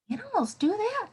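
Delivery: high, amazed question; mock surprise. Teacher to class, mock amazed question. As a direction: play up the wonder for the kids.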